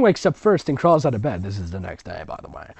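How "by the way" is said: usual speaking voice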